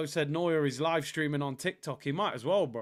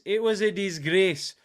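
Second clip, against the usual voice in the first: DISGRACE with a short Scottish "ay" vowel, louder; Scottish accent; 2 semitones higher, 5 dB louder than usual. Shown as a demonstration. Streamer corrects himself for chat, Scottish accent. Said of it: "I'll try and do a Scottish accent".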